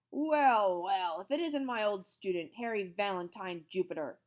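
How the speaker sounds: loud gloating growl. A narrator's reading. Old teacher growling at his former student. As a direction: savour catching him. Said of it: "growled Mister Kinderschmacker".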